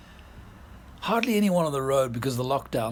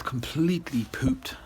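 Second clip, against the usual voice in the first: whispered; 4 semitones higher than usual. Real, not acted. Tired after late arrival.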